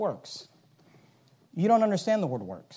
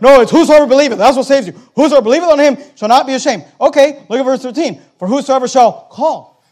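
loud, raised preacher's voice; full-force preaching; 6 semitones higher, 12 dB louder than usual. Acted. Preacher declaring scripture to the congregation, full-force preaching.

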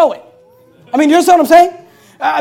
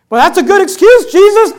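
pleading follower; 7 semitones higher, 9 dB louder than usual. Acted. Follower argues excuse with Jesus.

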